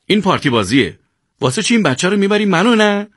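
high-pitched, aggrieved whine of protest; resentful. A narrator's reading. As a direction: complain about favouritism, hurt and insistent. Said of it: "سیاوش با دلخوری گفت". (اعتراض دلخورانه با صدای زیر)

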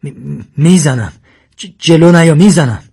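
loud, stuttering frightened threat; stammering in fear. Acted. Sasan faces the leopard with a shaking hand, stammering in fear. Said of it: "با تته پته گفت".

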